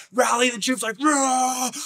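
high war whoop; rallying shout. Retold or imitated. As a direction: fire up the troops. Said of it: "He's like".

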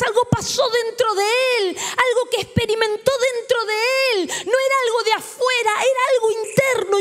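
rising emotion as he preaches; moved preaching. Real, not acted.

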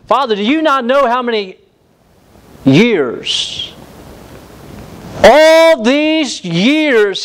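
high, slow and defiant, dragging out all these years; brazen tone. Acted. Elder son throws his years of service back at his father, brazen and high. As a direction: sneer it at the father like a wronged servant. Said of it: "this brazen tone".

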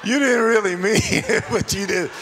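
laughing through the words; chuckling while you say it. Real, not acted. Preacher laughing with the congregation, laughing through words.

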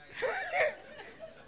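real high laugh. Real, not acted. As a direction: laugh out at the memory. Laughter at the story.

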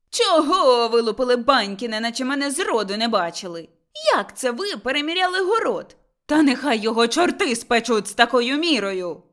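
loud, furious outburst; frantic shout. Acted. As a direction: shout at them as if beside yourself. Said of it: "гукнула не своїм голосом Мотря". (розлючений крик не своїм голосом)

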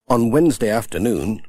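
usual speaking voice

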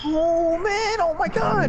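high, whining exclamation; high-pitched dismay. Real, not acted. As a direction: wail it high in disbelief. Gamer reacts in dismay to play.

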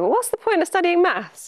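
high incredulous question; quoting a sceptic. Retold or imitated. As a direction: mimic a doubtful student asking. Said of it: "whenever I'm asked".

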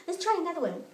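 ordinary talk

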